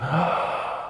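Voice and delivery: sharp breathless gasp of shock. Retold or imitated. Students seeing their teacher struck, shocked gasp. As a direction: suck in air as if stunned. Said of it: "They're like".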